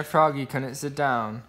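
ordinary delivery

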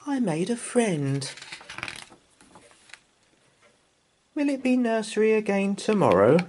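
put-on high, eager child's voice; happy little girl. A narrator's reading. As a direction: voice Anna proud and excited. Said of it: "said Anna".

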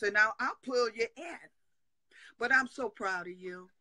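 ordinary talk, nothing marked

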